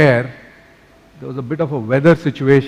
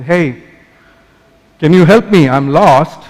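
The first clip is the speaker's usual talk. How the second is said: mock shout up to the ground, louder; calling out; 2 semitones higher, 8 dB louder, slower than usual. Lost balloonist calls to man on ground, mock shout. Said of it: "then he yelled out to him".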